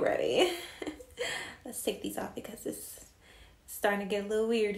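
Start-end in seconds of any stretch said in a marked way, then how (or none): none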